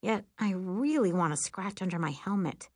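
usual speaking voice

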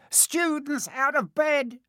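high drawn-out gleeful announcement; bellowed. A narrator's reading. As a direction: call it out to rouse the castle. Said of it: "Peeves bellowed".

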